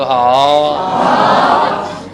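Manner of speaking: long, loud drawn-out gasp; jaw-dropped astonished ah; gasp of surprise. Shown as a demonstration. Teacher shows jaw-dropping surprise, loud and drawn out. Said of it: "बोलो".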